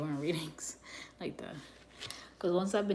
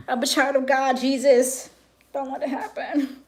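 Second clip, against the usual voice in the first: raised, pleading cry to Jesus; loud plea to God; 6 semitones higher, 9 dB louder than usual. Retold or imitated. To God, fighting her temper: a loud pleading cry. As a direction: beg Jesus loudly to keep her calm.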